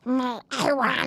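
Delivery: lightly voiced Gollum wanting something. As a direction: whine like a sad cat creature. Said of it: "like this".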